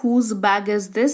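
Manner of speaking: very slow and clear; slow model question. Shown as a demonstration. Teacher slowly models the question to learners. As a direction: give it slowly. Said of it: "ये किसका bag है?".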